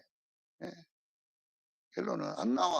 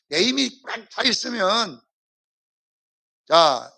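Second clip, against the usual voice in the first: ordinary delivery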